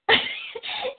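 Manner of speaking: high bright laugh; short high laugh. Real, not acted. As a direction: burst out laughing. Laughs at starstruck friend.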